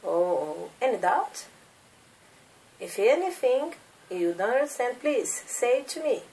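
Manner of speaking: usual speaking voice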